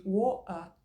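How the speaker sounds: British WATER with a glottal stop for the T; slow London WATER, no T, no R; British accent. Shown as a demonstration. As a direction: swallow the T the British way. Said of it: "uma pronúncia mais britânica".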